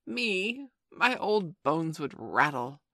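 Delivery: loud, slow, hammed-up old man; mock scandalized. A narrator's reading. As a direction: pretend outrage at the very idea. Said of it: "he pretended to be scandalized".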